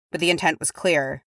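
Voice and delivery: ordinary delivery, nothing marked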